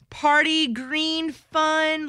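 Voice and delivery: high, drawn-out party hype; rowdy party animal. Acted. Hyping the party drink.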